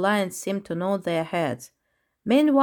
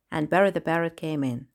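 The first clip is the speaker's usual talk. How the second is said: usual speaking voice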